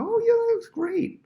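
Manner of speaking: high, slow sing-song gush of fake praise; mock gushing praise. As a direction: gush it like hollow flattery.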